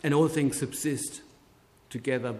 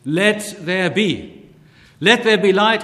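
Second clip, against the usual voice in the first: divine command; 6 semitones higher, 10 dB louder than usual. Acted. Preacher voicing Christ creating the world, in a loud commanding call.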